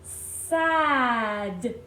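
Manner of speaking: "Sad" drawn into sssaaad; long s and a in "Sad", then d; slowly stretched out. Shown as a demonstration. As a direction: sound it out slowly for children to join. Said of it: "One more time".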